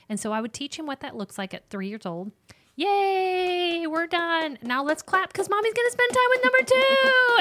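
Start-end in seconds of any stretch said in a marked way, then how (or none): 2.9-7.4 put-on high, bright cheer for a toddler; cheery mom voice. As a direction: cheer like a mom clapping with her three-year-old. Mom cheering child to celebrate.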